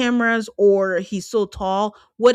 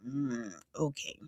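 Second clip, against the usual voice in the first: sceptical mm okay; 7 semitones lower, 16 dB quieter, slower than usual. Real, not acted. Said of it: "I'm like".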